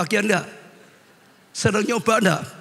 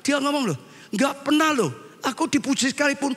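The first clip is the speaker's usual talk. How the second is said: aggrieved wife; 10 semitones higher than usual. Retold or imitated. Wife complains husband never praises.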